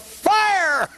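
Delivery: high shout; yelled. Acted. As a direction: yell fire like an order. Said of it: "He yelled".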